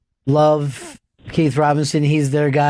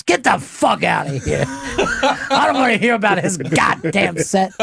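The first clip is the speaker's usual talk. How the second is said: Keith's gruff brush-off; 2 semitones higher than usual. Retold or imitated. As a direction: imitate a comic waving off praise with a laugh. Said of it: "And he'll be like this".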